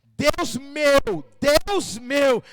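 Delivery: emphatic, drawn-out exclamation; intensified repeated cry. Acted. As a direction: call out to God, leaning on each repeat. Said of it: "só a palavra repetida vai potencializar a palavra".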